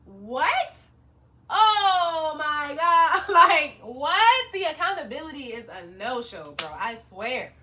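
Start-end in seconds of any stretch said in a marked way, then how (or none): 0.0-4.5 loud, stretched out oh my God; shocked disbelief. Real, not acted. Vlogger reacting to the stylist's refusal, loud and shocked. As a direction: cry out in disbelief.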